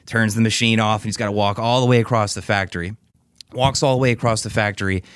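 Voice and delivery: usual speaking voice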